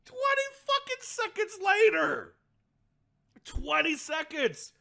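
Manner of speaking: high, loud, gleeful disbelief; shouted mocking amazement; incredulous shout. Real, not acted. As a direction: crow the punchline at full pitch. Shouting the punchline again.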